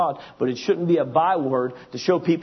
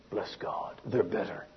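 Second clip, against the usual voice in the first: mock pious whisper, lower and much softer; smug whisper; 8 semitones lower, 11 dB quieter, slower than usual. Preacher mocks self-righteous believers in a sneering whisper. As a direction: breathe it out like a smug saint. Mocking smug religious people.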